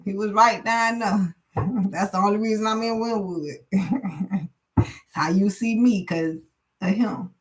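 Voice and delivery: talking through real laughter; laughing. Real, not acted. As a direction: chuckle as you tell it. Laughs while recalling the night.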